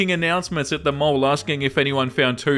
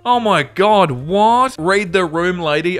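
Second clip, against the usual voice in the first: shocked disbelief; 3 semitones higher, 4 dB louder, slower than usual. Real, not acted. Reacting to rude mother in story.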